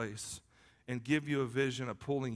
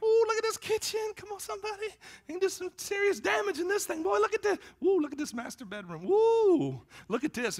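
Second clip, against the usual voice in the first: hammed-up, very high falsetto raving; gushing excitement; 5 dB louder than usual. Husband touring a house for sale, hammed-up excited gushing. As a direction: ooh and aah in mock delight. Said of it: "I get so excited about it".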